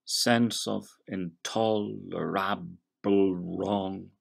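"Sense of intolerable wrong" sounded out slowly, syllable by syllable; slow, every syllable. Shown as a demonstration. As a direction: spell the meter out slowly, one beat at a time. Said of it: "if we go very slowly, sounding out each syllable".

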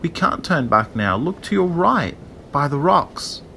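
lightly voiced tiny shell; soft coaxing. A narrator's reading. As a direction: guide gently in a little voice. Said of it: "the voice said softly".

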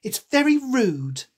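reprove, drawing it out; stern girl character; severe. A narrator's reading. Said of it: "Alice said with some severity".

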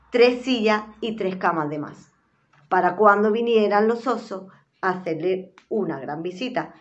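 ordinary delivery

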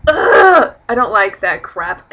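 loud groan of disgust, then slow complaint; fed-up, loud and emphatic; disgusted rant. Real, not acted. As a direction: groan loudly and reject it. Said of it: "done ranting".

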